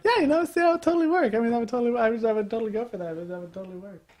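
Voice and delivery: put-on high, flustered girly gush, trailing off; mocking girly voice. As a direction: play a girl caught off guard and gushing. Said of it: "To which she replied".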